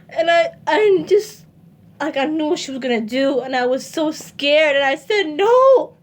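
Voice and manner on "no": high, loud, sobbing cry of no; retold scream. Begging her mom with the knife, screaming and sobbing. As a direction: scream it through tears. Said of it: "I screamed".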